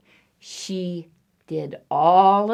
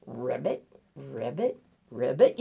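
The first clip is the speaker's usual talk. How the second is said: low croaks flicking upward; low froggy ribbits; 6 semitones lower, 7 dB quieter than usual. Acted. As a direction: croak like a little frog. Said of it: "Something that goes ribbit".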